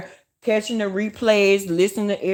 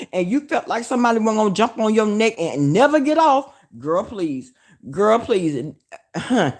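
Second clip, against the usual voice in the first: ordinary talk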